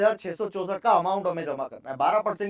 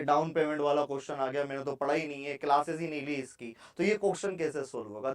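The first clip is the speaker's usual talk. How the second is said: imitated students; 4 semitones lower, 6 dB quieter than usual. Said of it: "बहुत सारे बच्चे ये वर्ड पढ़कर ही क्वेश्चन छोड़".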